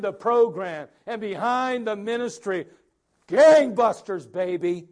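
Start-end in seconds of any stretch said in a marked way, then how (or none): 3.3-4.9 loud, slow, drawn-out word; emphatic. Real, not acted. Pastor stressing church zeal to the congregation, loud. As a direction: belt it out slowly. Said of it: "emphatic sermon flourish".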